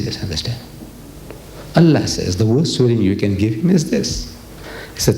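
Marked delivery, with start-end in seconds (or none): 0.0-0.6 low, hushed, submissive answer; mock-meek murmur; meek reply. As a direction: answer small and soft, as if told off. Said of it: "You understand? I said".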